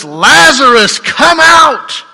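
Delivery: Jesus shouting into the tomb; loud voice. A narrator's reading. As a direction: call the dead man out of the tomb. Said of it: "he cried out with a loud voice".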